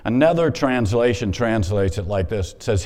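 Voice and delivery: usual speaking voice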